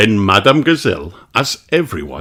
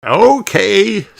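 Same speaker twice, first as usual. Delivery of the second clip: Grandpa voice; 14 semitones higher, 5 dB louder than usual. A narrator's reading. Grandpa Pig answers.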